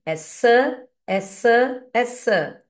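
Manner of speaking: "Essa, essa, essa" said clearly three times for repeating; teacher drilling "essa"; modelled pronunciation. Shown as a demonstration. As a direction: drill "essa" slowly and cleanly. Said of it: "Eu costumo falar assim".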